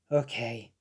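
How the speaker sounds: soft sigh then a low, soft okay; sighing, calming down. Retold or imitated. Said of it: "started to calm down".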